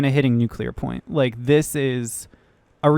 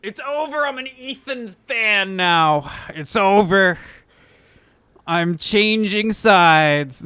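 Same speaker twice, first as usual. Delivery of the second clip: high, drawn-out fake lament; mock despair; 4 semitones higher, slower than usual. Streamer jokes about switching sides over a mispronounced name, mock despair. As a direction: wail it slowly in jest.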